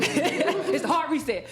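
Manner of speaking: ordinary delivery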